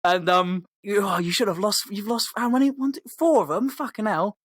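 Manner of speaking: lightly voiced wizard, fed up and rising; mock exasperated telling-off. Gandalf telling off Aragorn for losing the hobbits, mock exasperated.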